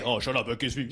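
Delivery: a doll hushing and demanding a kiss; toy figure low and soft. Pretend. First toy figure insists.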